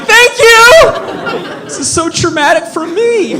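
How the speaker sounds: very high hammed-up wail; overwrought squeal. Dad dismissed from the delivery room, high mock-traumatised wail.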